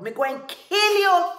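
very high, very loud threat; furious roar. A narrator's reading. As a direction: scream the threat at full force. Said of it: "Tiger roared".

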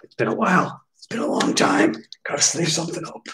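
breathy, half-voiced mock pleading; mock desperation. Collector talking himself into sleeving a card, mock desperate. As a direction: play a desperate collector, voice thinning out.

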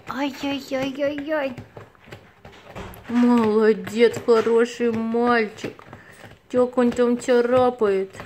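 loud, slow cooing pet praise; squeaky praising a dog. Real, not acted. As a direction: drawl praise at the cat. Praising the cat, louder.

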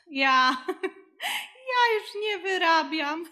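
high helpless laughter through the words; laughing. Real, not acted. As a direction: laugh in disbelief, overwhelmed. Overwhelmed by twins, laughing. (bezradny śmiech)

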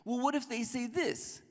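high, whining voice; whiny Moses. Retold or imitated. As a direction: whine it like a reluctant kid. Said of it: "He keeps whining".

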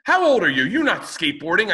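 very high, loud scornful woman; mocking neighbor. Neighbor scoffing at a grown man skateboarding, high loud mimicry. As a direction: scoff at his age. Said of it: "She goes".

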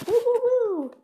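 high happy woo-hoos; cheering. Real, not acted. Kid bragging to viewers about beating the game, cheering. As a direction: celebrate the win out loud.

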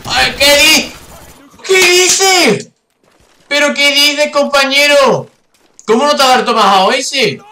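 high-pitched, indignant yelling; incredulous shout. Real, not acted. Gamer whose Tomahawk throw missed, incredulous high shout. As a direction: protest loudly in high-pitched disbelief.

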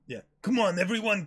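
usual speaking voice

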